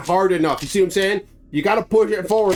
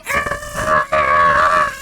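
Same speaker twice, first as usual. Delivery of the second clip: mock scream; 14 semitones lower, 4 dB louder than usual. Girl screaming.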